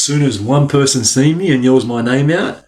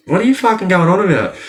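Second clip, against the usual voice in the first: fast, high, mock-baffled denial; incredulous outburst; 9 semitones higher, faster than usual. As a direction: rattle it off high, feigning confusion. Acts out his denial.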